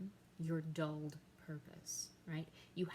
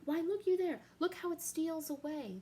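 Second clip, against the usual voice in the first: much higher and louder, pointing wildly; frantic shout; 10 semitones higher, 8 dB louder than usual. Acted. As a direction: cry out in desperate excitement. Hamlet points at ghost.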